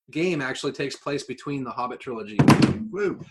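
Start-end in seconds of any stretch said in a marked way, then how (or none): none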